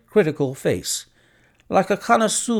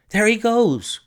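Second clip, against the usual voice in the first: higher, louder pointing cry; excited cry; 10 semitones higher, 4 dB louder than usual. A narrator's reading. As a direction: call it out, pitched up. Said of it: "cried Hall Pycroft".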